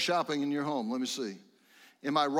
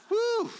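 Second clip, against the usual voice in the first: very high, short "whoo"; high whoop; 4 dB louder than usual. Real, not acted. Preacher waking up the morning congregation, whooping. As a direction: let out a wake-up whoop.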